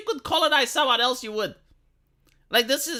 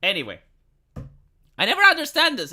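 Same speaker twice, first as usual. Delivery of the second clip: ordinary delivery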